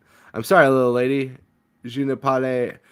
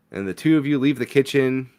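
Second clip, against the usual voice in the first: usual speaking voice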